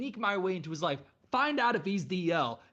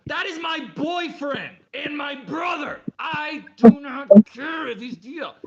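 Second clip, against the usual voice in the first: mock furious rant; 6 semitones higher, 12 dB louder than usual. Outraged ranting at the story.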